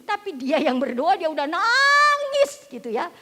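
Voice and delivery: high, loud, slow exclaim; stretched-out preacher's exclamation; preaching outburst. Acted. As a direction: cry it out slowly to the church. Preacher's emotional climax.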